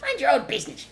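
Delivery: very high, loud shriek. A narrator's reading. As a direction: shriek back rudely. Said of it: "shrieked Rat".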